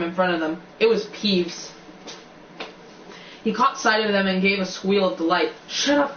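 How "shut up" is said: higher-pitched urgent plea; acted anxious interjection; urgent hushing. A narrator's reading. Acted plea to silence Peeves.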